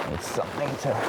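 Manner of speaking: usual speaking voice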